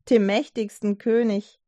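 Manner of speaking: ordinary delivery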